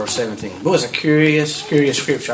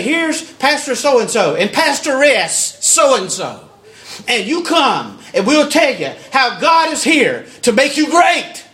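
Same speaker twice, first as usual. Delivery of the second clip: higher and louder, slowed, laughing, ending in a shout; mocking billboard pitch; 7 semitones higher, 3 dB louder, slower than usual. Preacher mocking a celebrity pastor's billboard, raised and laughing. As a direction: ham up a showy church ad. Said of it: "put some billboards up and say".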